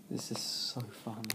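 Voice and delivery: whispered dry sarcasm; sarcastic whisper. Real, not acted. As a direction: breathe it out as a deadpan aside. Sarcastic aside about questions.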